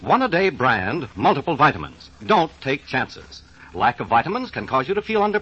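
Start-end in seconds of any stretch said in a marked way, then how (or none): none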